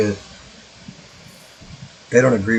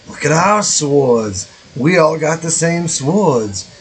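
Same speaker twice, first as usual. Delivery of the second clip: slow, higher Boston-Jersey-ish drawl on swords; mystery Boston-Jersey accent; 5 semitones higher, slower than usual. Pretend. Neighbors bragging about their identical swords, in a vague Boston-Jersey accent. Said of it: "I don't know what accent that is".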